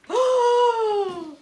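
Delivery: big high shouted gasp of surprise; loud gasp. A narrator's reading. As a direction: gasp loudly in shock. Reacting to monkeys in tree.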